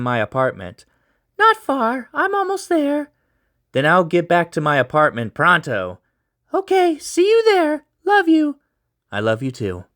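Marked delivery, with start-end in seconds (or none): 1.4-3.1 put-on high young woman's voice on the phone; sister's voice. Narrator voicing the sister on the phone, high girlish voice. 6.5-8.6 put-on high young woman's voice on the phone; sister's voice. Narrator voicing the sister on the phone, high girlish voice.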